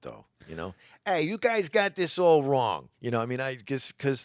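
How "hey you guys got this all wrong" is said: put-on slow, high New York accent. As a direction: drawl it like a New York cabbie. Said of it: "with a New York accent".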